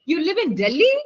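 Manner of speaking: "Delhi" rising as a question. Shown as a demonstration. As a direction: end on a clear upward swing on "Delhi". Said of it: "tone is rising".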